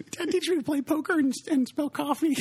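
put-on high child voice through laughter; laughing kid voice. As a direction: ask like a giggling little kid. Replaying the kid's question.

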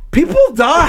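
high, loud exclamation of disbelief; high-pitched, loud protest; appalled outburst. Real, not acted. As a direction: cry it out, incredulous and appalled. Laughing yet appalled at true story.

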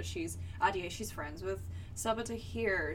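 normal talking voice

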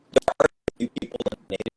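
choppy, breaking up call audio; garbled glitchy call connection. Real, not acted. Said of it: "You're breaking up again".

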